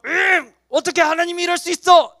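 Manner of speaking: high, whining protest; mock indignation. Acted indignant protest against God. (억울해서 따지는 연기)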